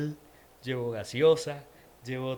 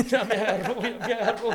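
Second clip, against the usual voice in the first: high and louder, laughing through the words; 8 semitones higher, 7 dB louder than usual. Retold or imitated. Caught clapping in church, laughing at himself. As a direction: laugh it out as the joke lands on you.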